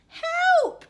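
high, loud storybook cry; cry for help. A narrator's reading. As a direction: shout up high for rescue. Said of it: "cried the witch".

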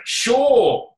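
high, loud, drawn-out retold 'sure'; breezy agreement. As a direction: act out an offhand, emphatic yes.

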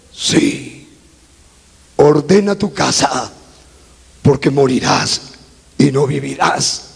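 deep, grave sentence handed down; voice of God. Retold or imitated. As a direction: pronounce it low and solemn, with pauses. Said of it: "Dios me dice". (voz de Dios, grave y solemne)

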